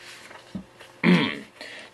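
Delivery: throat clearing. Acted. Clearing throat before chapter one.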